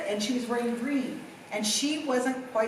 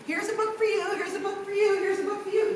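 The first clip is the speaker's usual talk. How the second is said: higher, breezy host voice handing things out; mimicking the psychic; 9 semitones higher than usual. Psychic handing out books to VIPs, higher breezy voice. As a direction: toss off each line like a busy host. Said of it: "the guy's handing out books".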